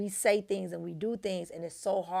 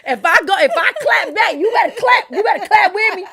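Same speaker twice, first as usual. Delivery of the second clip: much higher and louder, laughing through it; mock fired-up demand; 12 semitones higher, 17 dB louder than usual. Wife laughing, telling husband to clap back with her. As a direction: hype it up like ready to brawl.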